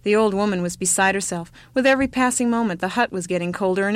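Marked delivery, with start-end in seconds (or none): none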